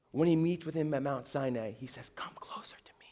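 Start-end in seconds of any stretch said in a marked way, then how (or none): 2.1-3.1 barely audible, voiceless invitation; hushed whispered voice of God; gentle whisper. Acted. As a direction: whisper to draw him close. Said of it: "the sound of a gentle whisper".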